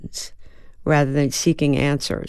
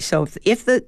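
normal talking voice

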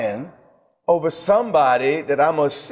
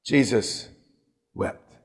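low, hushed, solemn reading of "Jesus wept"; solemn quote; 5 semitones lower, 5 dB quieter, slower than usual. Shown as a demonstration. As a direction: let "Jesus" fall and barely breathe "wept". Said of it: "Scripture says".

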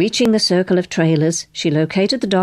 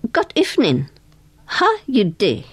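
pidgin English; 5 semitones higher than usual. A narrator's reading. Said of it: "Know any pidgin English".

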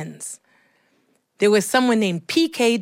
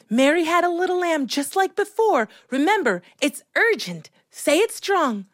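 higher, emphatic encouragement; lightly voiced insistent drama coach; coaching teacher; 7 semitones higher than usual. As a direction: urge a shy child on. Teacher coaching child on stage.

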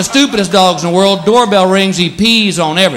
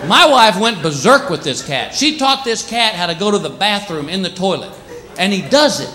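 ordinary talk

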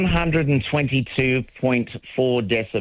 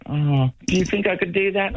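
musing self-question; 8 semitones higher than usual. Retold or imitated. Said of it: "And I kinda went".

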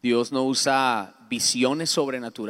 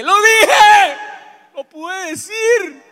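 much higher, loud cry of release; triumphant shout; 13 dB louder, much slower than usual. Retold or imitated. Finally admitting he's not well, triumphant shout. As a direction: shout it like a breakthrough. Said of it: "yo grité y dije".

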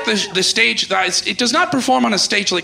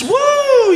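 woo call; 5 dB louder than usual. Retold or imitated. Imitating Ric Flair crowd woo.

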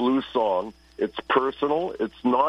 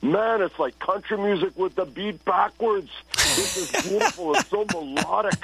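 higher, gushing rave about new music; excited discovery; 7 semitones higher than usual. Retold or imitated. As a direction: gush like you just heard it first. Recalling first hearing reggae, enthused.

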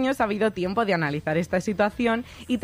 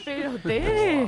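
mock outrage; 6 semitones higher, much slower than usual. Real, not acted. Outraged she missed the film.

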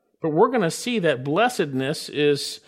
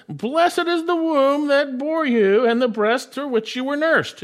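woman calling out, much higher pitched; raised voice; 10 semitones higher, 4 dB louder than usual. Acted. Woman in the crowd calls to the Lord, raised voice. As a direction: lift the voice high over a crowd. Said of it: "raised her voice and said to him".

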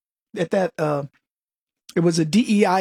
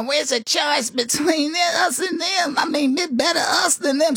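higher, slow mock politician shouting the line; Kamala Harris impression; 8 semitones higher than usual. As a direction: parody a politician's rally shout. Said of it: "I played Kamala Harris".

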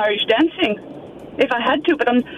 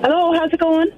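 put-on lightly lilting Irish accent, pitched up; 5 semitones higher than usual. Caller passing as her friend Sarah, Irish accent. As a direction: greet them in an Irish accent. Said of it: "bit of an accent".

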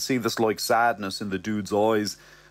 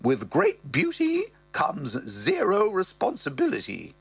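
higher, grand mother voice; mother's voice; 6 semitones higher than usual. Acted. Mother recalling angry fathers, higher voice. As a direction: lift up and hold court as the mother. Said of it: "She goes".

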